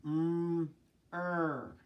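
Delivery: "Mm" and "er" held as separate sounds; sounding out. Shown as a demonstration. Teacher tapping out mer for the class, each sound held apart. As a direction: stretch "Mm", pause, then "er". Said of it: "Let's tap out mer first".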